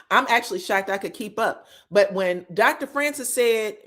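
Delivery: ordinary talk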